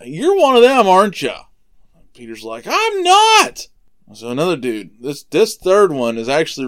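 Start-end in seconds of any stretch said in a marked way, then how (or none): 0.0-1.3 high, loud challenge; acted accusation. Onlooker accuses Peter. 2.7-3.7 high-pitched, loud acted protest; exaggerated, defensive yell; indignant denial. Peter's second denial, retold.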